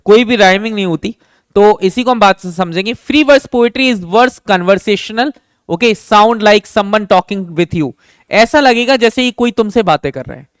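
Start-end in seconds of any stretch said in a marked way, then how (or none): 2.9-7.9 slow dictation. Shown as a demonstration. Teacher dictating definition.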